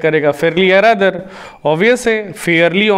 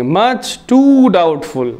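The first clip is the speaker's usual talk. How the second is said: drawn-out much too doubtful; teacher drawing out the correct form; slow emphatic model; much slower than usual. Shown as a demonstration. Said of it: "much too doubtful ही बोलेंगे".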